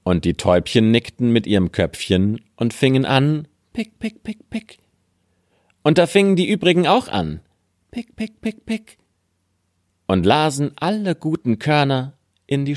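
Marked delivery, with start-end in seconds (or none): 3.7-4.8 high, hushed little pick sounds; tiny quiet pecks; soft bird pecking. Acted. Täubchen picken die Linsen. 7.9-9.0 high, hushed little pick sounds; tiny quiet pecks; soft bird pecking. Acted. Übrige Vögel picken mit.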